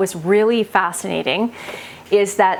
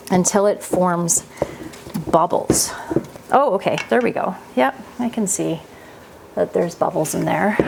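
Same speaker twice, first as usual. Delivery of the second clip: ordinary talk, nothing marked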